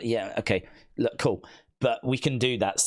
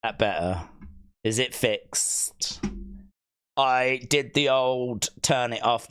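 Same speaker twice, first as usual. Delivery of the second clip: normal talking voice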